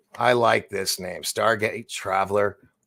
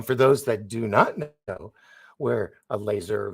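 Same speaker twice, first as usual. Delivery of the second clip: ordinary talk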